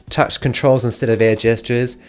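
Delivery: ordinary talk, nothing marked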